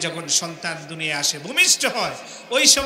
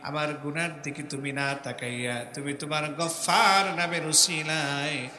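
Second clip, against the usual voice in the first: pleading prayer; 4 semitones lower than usual. Acted. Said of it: "আসামির মতো হয়ে আল্লাহর কাছে মাপ চাইতে হবে". (নরম নিচু গলায় কাতর মোনাজাত)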